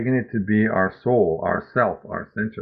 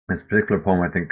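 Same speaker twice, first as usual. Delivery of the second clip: usual speaking voice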